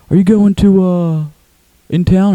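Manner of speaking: high, halting, loud mimicry; Tim impression. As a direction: imitate his persistent question. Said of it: "he talks like this".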